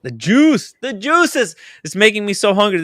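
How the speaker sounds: high, loud food craving; excited exclamation. Real, not acted. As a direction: cry out hungrily at the sight of food. Drooling over food on stream.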